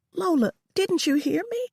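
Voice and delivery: high, pleading question. Acted. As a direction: beg her to listen. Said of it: "I pleaded".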